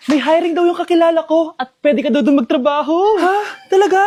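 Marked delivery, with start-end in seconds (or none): 3.2-4.0 surprised. Acted. Hears of job offer.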